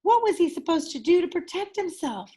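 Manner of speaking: usual speaking voice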